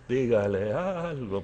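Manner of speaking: slow, drawn-out mock repeat; incredulous inner echo. Pastor privately echoing the lady's demand, slow and incredulous. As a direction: echo the demand slowly under your breath. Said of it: "pensé yo".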